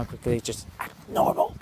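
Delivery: urgent hushed whisper. Real, not acted. Vlogger warns the viewers in a whisper as people approach. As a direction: whisper it fast as people pass. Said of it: "I don't wanna talk right now".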